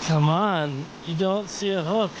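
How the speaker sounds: normal talking voice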